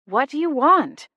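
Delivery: out of patience, demand it; clipped exasperated question; impatient demand. A narrator's reading. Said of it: "last shred of patience".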